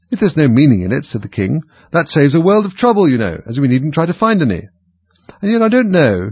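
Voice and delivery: high, loud, fussy king. A narrator's reading. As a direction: fuss over the verses like a king. King speaks.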